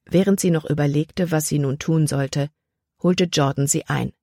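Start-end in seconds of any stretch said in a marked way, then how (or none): none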